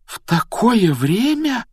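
very high, drawn-out question; aghast, pitch shooting up; incredulous dismay. A narrator's reading. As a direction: protest in slow disbelief. Harry dismayed at the news.

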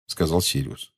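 ordinary delivery

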